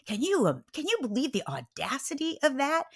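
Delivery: high-pitched outraged appeal; retold indignant prayer; venting to God. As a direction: complain upward in sheer disbelief. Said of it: "I start venting to God".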